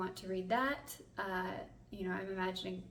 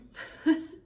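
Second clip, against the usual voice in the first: short embarrassed laugh; 11 dB louder than usual. Real, not acted. Laughs at filming in the bathroom.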